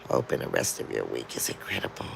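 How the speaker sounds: whisper. Real, not acted. Soft goodbye to a viewer.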